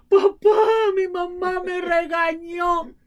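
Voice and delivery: put-on high, whiny child's cry to papa; tearful little girl complaining to dad. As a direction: whimper like a scolded little girl. Child running crying to father.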